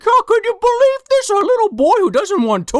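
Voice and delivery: high-pitched incredulous Santa voice; mock Santa. Santa reacting to the boy's letter, high mock disbelief. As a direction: raise the pitch in hammed-up disbelief. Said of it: "He's like".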